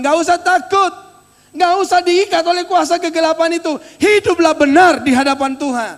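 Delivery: loud, high exhortation to the congregation; forceful preaching. Real, not acted.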